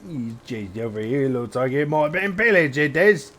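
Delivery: high, loud hammed-up British-French accent; mixed British-French accent. Streamer voicing a guard, high, loud, hammy accent. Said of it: "switching between British and freaking French".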